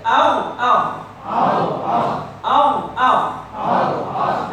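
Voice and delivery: loud Ow, ow from the top of the throat; loud throat demo. Shown as a demonstration. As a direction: punch Ow, ow. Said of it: "And then right for ah".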